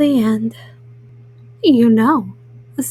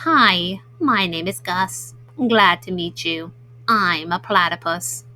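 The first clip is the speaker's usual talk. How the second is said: Gus; 6 semitones lower than usual. Acted. New guy introducing himself to Pete, low and friendly. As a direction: introduce yourself in a deep, friendly character voice. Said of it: "The new guy said to Pete".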